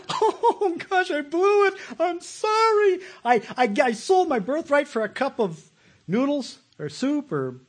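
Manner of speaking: high, squeaky mock-sorry wail; whining Esau. Preacher mocks Esau begging after selling his birthright, in a high whiny voice. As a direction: lament a lost birthright in a silly high voice. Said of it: "Esau, he cried".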